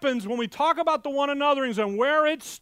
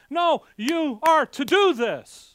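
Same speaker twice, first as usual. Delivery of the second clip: usual speaking voice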